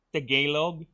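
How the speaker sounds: ordinary talk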